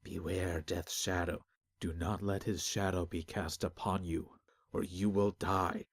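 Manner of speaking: soft, slow ominous muttering; low murmur of a frightened crowd; muttered warning. Acted.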